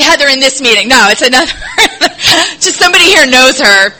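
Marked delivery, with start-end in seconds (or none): 1.6-2.5 tearful laugh. Real, not acted. Laughing at Heather mix-up, tearful.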